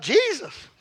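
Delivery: re-enacted high-pitched cry; retold startled cry; yelling out. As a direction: yell it high as if the crash is coming. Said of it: "I yelled out".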